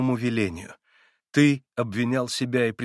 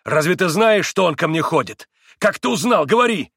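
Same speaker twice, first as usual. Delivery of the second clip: frantic demand; 8 semitones higher, 9 dB louder than usual. Acted. Иван frantic questioning.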